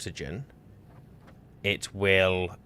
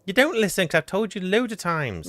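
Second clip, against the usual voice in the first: much higher, louder frustrated whine; high-pitched exasperation; 11 semitones higher, 5 dB louder than usual. Real, not acted. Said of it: "you're getting high pitched".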